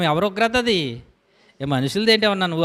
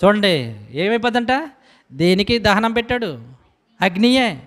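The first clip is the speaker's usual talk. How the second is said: ordinary delivery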